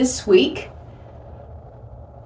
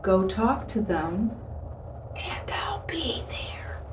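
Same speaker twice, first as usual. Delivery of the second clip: soft call fading into a slow whisper; little whisper; 8 dB quieter than usual. Retold or imitated. God gently urging the believer to go, little whisper. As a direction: begin quietly, then whisper the promise. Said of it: "the little whisper".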